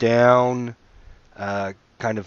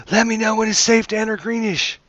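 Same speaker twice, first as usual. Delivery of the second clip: high, loud call from a distance; yelling back; 11 semitones higher, 5 dB louder than usual. Acted. Merchant yells back to the party from the bushes, yelling. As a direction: holler it back, pitched way up. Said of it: "he yells back".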